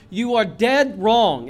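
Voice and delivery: "dead" hit hard and loud, slowly; stress on dead. Real, not acted. Preacher warning those who trust in being good, stress on "dead". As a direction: lean heavily on "dead", slowing the phrase. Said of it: "I emphasize the word dead".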